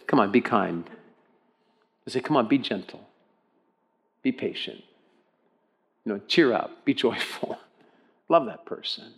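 soft, low coaxing with long pauses; quietly urging, as a kindly inner voice; gentle nudge. Retold or imitated. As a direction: nudge them softly, a phrase at a time. Voicing the Spirit's gentle nudges.

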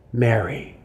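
soft, low, tender calling of a name; quiet, tender Mary. Acted. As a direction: say Mary gently, like someone who knows her. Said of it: "in that familiar voice and the familiar tenderness".

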